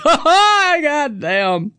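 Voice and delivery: high loud laughing curse. Real, not acted. Host cracking up at his own joke, laughing. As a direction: laugh through it.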